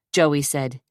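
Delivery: ordinary delivery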